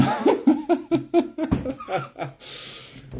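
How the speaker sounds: high-pitched cackle at own joke; long, high run of laughter; laughing. Acted.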